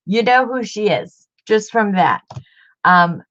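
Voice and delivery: usual speaking voice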